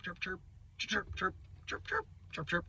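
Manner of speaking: high chirping bird imitation, chirp chirp chirp; imitated birdsong. Telling viewers about birds while digging, imitating chirps. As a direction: chirp like little birds in the morning.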